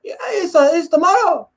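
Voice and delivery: very high mock fighter voice; Yoel Romero. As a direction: squeak it out with a heavy accent. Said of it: "his all high voice".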